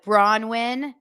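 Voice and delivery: usual speaking voice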